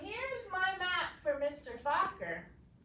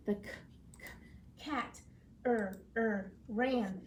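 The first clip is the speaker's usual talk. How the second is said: halting reading; 4 semitones lower than usual. Acted. Girl stumbles reading aloud.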